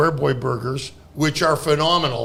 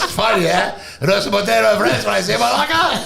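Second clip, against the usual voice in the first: put-on louder, accented accusing jabs; mock freak-out; 3 semitones higher, 5 dB louder than usual. Cook blaming the teller, loud mock freak-out. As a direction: throw it out as hammed-up Greek anger. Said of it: "you watch him freak out".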